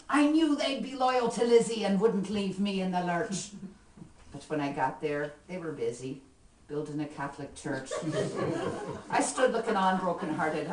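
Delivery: lively Irish ballad recitation, rhythmic; poem recitation. Acted. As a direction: perform the comic poem with swing.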